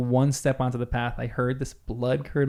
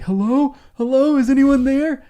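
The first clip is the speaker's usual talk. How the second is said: high, loud nervous calling into the dark; 11 semitones higher, 5 dB louder than usual. Retold or imitated. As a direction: call out into the woods, scared. Friend calling out in dark woods.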